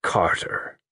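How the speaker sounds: thick, half-whispered name. A narrator's reading. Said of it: "voice came thickly".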